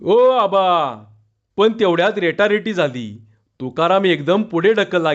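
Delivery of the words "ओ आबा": long drawn-out call, slightly raised; calling out loudly. A narrator's reading. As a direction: stretch the words as if hailing someone across a crowd. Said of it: "तो मोठ्याने ओरडला". (लांबवून मारलेली हाक)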